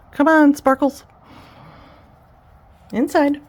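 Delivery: high, loud call to the dog. Real, not acted. Owner calling her dog Sparkles in from the garden, loud high call. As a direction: sing out to bring the dog inside.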